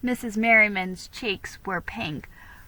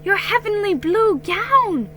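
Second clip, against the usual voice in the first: thrilled mother; 11 semitones higher, 6 dB louder than usual. Acted.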